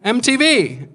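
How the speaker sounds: excited fan's yell, imitated; imitated shout. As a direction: call out to a celebrity across the street. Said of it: "a guy just shouts at me".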